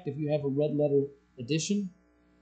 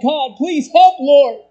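loud, high cry for help; desperate prayer; 10 semitones higher, 13 dB louder, slower than usual. Pretend. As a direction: plead with God in a crisis. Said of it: "You're like".

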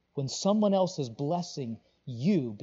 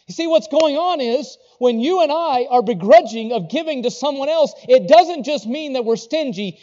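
loud, high, driving pulpit voice; full-force preaching; 8 semitones higher, 14 dB louder than usual. Acted. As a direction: hammer it home from the pulpit.

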